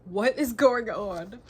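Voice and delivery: loud, slow, drawn-out cry of alarm. Real, not acted. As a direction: demand it in disbelief. Alarm at the plot.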